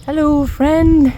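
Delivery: slow and high, gently; high, slow greeting. Real, not acted. Greeting a small animal.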